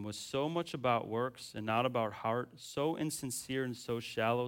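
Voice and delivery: normal talking voice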